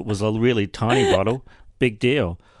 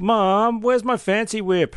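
high whiny shout to mom; yelling across the room; 9 semitones higher, slower than usual. Pretend. Playing the spoilt son yelling for mom.

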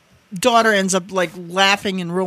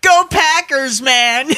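1 laughing; 8 semitones higher, much slower than usual. Real, not acted. Laughing counter cheer.

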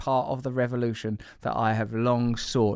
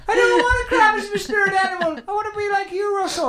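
high whine; 3 dB louder, faster than usual. Real, not acted. Whining about spirit animal.

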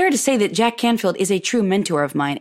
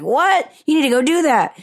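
imitating excited mom; 8 semitones higher than usual. Mom urging her daughter to go, higher excited imitation. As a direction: play mom insisting eagerly.

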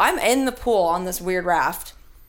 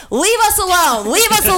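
high, loud mock yell at a crew member; Gary yelling; 11 semitones higher, 6 dB louder than usual. Gary yells at the crew guy to leave, loud mock yell. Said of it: "So he yells at him".

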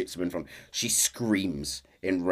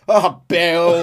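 fond exasperation; 8 semitones higher, 10 dB louder than usual. Acted. Exasperated sigh at Bill.

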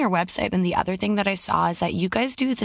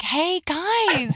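higher-pitched mock creepy hello; creepy greeting; 7 semitones higher than usual. Host teasing guest about creeping on parents, mock creepy greeting. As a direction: lightly play an over-friendly creeper. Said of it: "Did you creep on the parents?".

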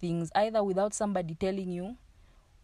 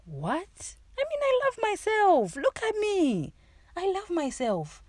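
mimicking her own indignant disbelief, pitched way up; incredulous protest; 13 semitones higher than usual. Protesting to the inner voice in a high, indignant squeal. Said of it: "And I was like".

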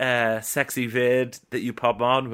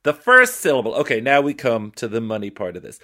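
ordinary delivery

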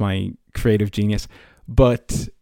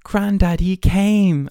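put-on much higher, wide-eyed kid voice; imitating the boy in the Christmas ad, high and eager; mock TV ad kid; 8 semitones higher, much slower than usual. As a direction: play the ad child's big reveal. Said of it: "And he was like".

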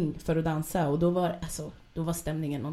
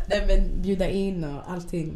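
ordinary delivery

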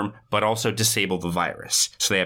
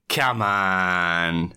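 drawn-out "come on"; retold plea; 3 semitones lower than usual. Kate to the two reluctant hackers, drawn-out plea. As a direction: stretch it out in mock exasperation. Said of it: "Kate kinda goes".

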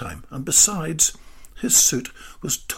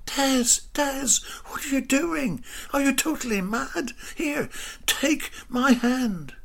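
alarmed; 12 semitones higher than usual. A narrator's reading. Greta rushes out alarmed.

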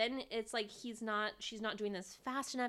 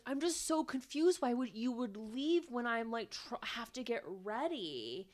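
higher-pitched imitation of a put-out husband; mock confused complaint; 4 semitones higher than usual. Imitating husband complaining to wife about leaving, mock confused. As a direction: whine it lightly, as if baffled.

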